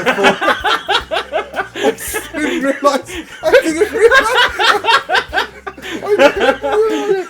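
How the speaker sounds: high loud words through laughter; breaking up with laughter; laughing too hard to speak. Real, not acted. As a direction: try to talk through a laughing fit. Laughing fit, can't finish.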